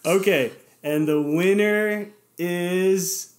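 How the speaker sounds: slow, drawn-out reveal; suspense announcement. Real, not acted. Host slowly announces the giveaway winner to listeners. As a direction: stretch it out to build suspense.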